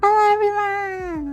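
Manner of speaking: high, sustained singsong hello; stream greeting. Real, not acted. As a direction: stretch out the greeting in a high held note. Host's drawn-out stream greeting.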